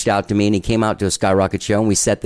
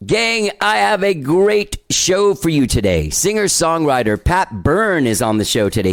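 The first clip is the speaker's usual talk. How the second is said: slow, much higher host announcement; show intro; 7 semitones higher, slower than usual. Real, not acted. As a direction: roll out the welcome like a show host. Podcast host opening the show.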